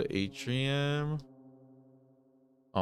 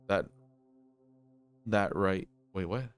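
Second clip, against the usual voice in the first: usual speaking voice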